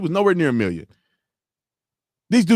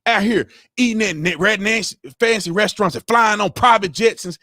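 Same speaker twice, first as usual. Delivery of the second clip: ordinary talk, nothing marked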